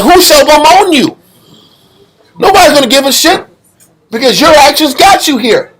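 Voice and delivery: high-pitched, scornful challenge as God; indignant rebuke. Retold or imitated. God scolding the condemned, retold.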